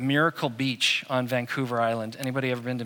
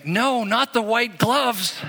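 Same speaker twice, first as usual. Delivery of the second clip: alarmed protest; 6 semitones higher, 3 dB louder, slower than usual. Retold or imitated. Stopping the Queen cleaning the toilet.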